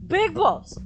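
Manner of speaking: psyching up. Real, not acted. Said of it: "time to grow some big balls".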